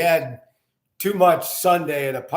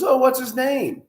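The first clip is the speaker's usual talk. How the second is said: patient's curious question, a bit higher; mild interest, voiced higher; 9 semitones higher than usual. Patient asks the chiropractor the winery owner's name, lightly curious. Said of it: "and he goes".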